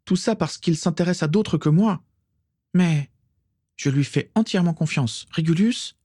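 high-pitched, overwrought confession; desperate, fervent self-justification; agitated exclamation. A narrator's reading. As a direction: pour it out feverishly, voice way up high. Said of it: "s'exclama Bellatrix".

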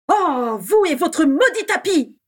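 high, loud, drawn-out exasperation; explosive anger. A narrator's reading. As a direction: blow up with scorn. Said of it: "explosa Bellatrix". (explosion de colère exaspérée)

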